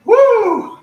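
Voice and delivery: loud high whoop of joy; excited whoop. Real, not acted. Instructor cheers to the class after the song, loud high whoop. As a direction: let out a big cheer. Finishing the song mid-workout.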